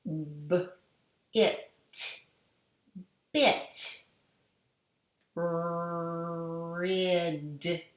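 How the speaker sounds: "B, it, bit" sesleri ayrı ayrı, sonra birleştirerek; sounding out. Shown as a demonstration. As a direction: segment each sound slowly then blend into the word. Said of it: "sound these out with me".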